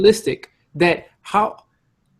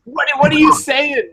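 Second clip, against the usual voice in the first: laughing disbelief; 9 semitones higher, 3 dB louder than usual. Real, not acted.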